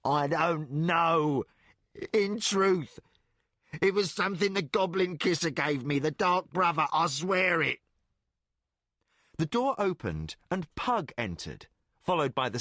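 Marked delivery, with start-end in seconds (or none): 0.0-7.8 higher, sobbing denial; sobbing plea. Acted. Tortured thief swears to the king, sobbing. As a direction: plead through sobs. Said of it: "Jack sobbed".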